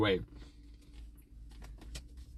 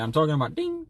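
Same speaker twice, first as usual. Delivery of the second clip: usual speaking voice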